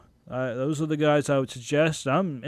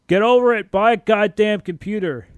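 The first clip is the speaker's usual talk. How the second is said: loud, fed-up snap; a sudden burst of real irritation; exasperated outburst; 4 semitones higher, 8 dB louder than usual. Real, not acted. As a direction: tell them off, loud and fed up. Angry rant at PS3 hackers.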